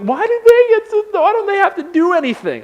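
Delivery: put-on high-pitched, tearful child's protest; mock crying whine. Eight-year-old complaining to mom about the younger kids, mock-crying whine. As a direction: whine it high like an upset kid. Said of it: "I'm, like, crying. I'm like".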